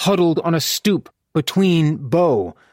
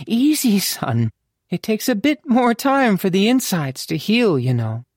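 higher-pitched mother character voice; fond, teasing mother; 8 semitones higher than usual. Acted. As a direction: lift the voice high for a mother easing her son off.

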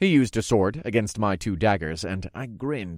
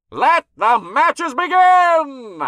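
high, booming, drawn-out announcer cry; announcer's shout; 8 dB louder, much slower than usual. A narrator's reading. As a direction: shout it slowly into a megaphone. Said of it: "then he shouted into it".

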